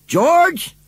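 wife calling loudly for George. A narrator's reading. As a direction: shout for him from another room. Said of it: "Missus Braithwaite called".

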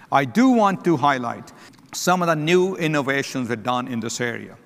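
normal talking voice

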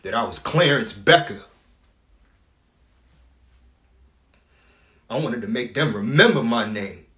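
loud, emphatic self-assertion; defiant declaration. Acted. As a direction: proclaim your name loudly, punching the key words. Asserts his name and worth.

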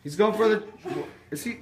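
lively ringside play-by-play; wrestling commentator. Acted. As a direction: call the match like a TV announcer.

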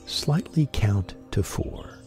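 ordinary talk

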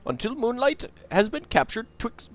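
high character voice, declaiming verse; genie reciting a prophecy. Acted.

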